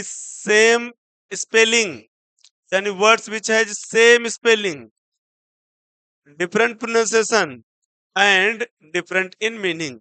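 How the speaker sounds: slow, spaced definition; slow dictation. Shown as a demonstration. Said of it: "definition dictated".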